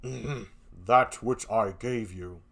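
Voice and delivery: low, very slow pointed Othello. Acted. Othello voiced in play reading.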